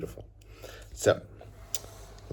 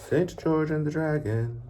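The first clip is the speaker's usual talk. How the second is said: title sung out much higher; playful high title; 11 semitones higher, 6 dB louder than usual. Shown as a demonstration. Teacher announcing the book title high and playful. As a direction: call the title out high and bright to the kids. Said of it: "And one more".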